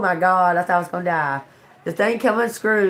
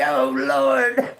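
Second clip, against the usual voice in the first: exasperated oh lord; 8 semitones higher than usual. Retold or imitated. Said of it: "I'm like".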